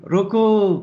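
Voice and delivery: high, drawn-out call to stop; acted shout, pitched well up; crying out. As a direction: call out sharply to halt a driver. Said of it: "कुछ ऐसा देखा कि चीखे".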